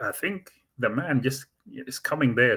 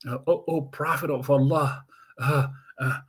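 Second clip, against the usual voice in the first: panting, stammering panic, slightly higher; out of breath; 5 semitones higher than usual. Retold or imitated. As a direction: gasp the words out like you ran all the way. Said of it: "and he's out of breath".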